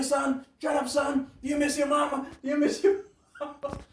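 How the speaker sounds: high, loud mocking drill-instructor shouts; yelling drill sergeant, laughing at the end. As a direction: bark questions at a recruit until you crack up. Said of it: "then they ask you".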